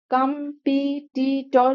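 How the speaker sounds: Competitor stretched out slowly in separate syllables. Shown as a demonstration. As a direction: sound out competitor very slowly, piece by piece. Sounds out spelling by syllables.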